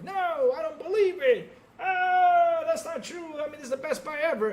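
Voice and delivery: high, loud fan denial; mocking outraged fans. Streamer imitating friends' outraged denial, high and loud. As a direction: wail like a fanboy in denial. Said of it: "And they were like".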